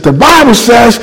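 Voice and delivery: high, bold declaration; now he owns the pulpit; confident preaching. Retold or imitated. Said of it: "and I began to preach".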